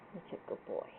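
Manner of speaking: soft whispered praise; whispering. Real, not acted. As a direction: breathe it to the dog, unvoiced.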